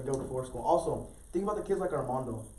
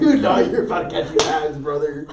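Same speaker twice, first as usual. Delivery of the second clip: mock threat; 12 dB louder than usual. Joking threat among friends.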